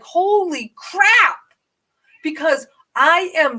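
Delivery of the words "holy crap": high, slow stunned exclamation. Retold or imitated. Realizing she's far from help, high stunned gasp. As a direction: draw it out in disbelief. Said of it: "I was like".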